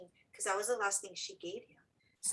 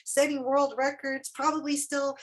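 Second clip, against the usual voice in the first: loud, higher, insistent prediction; retold pep talk, voice raised; emphatic reassurance; 5 semitones higher, 11 dB louder than usual. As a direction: talk him up with loud conviction.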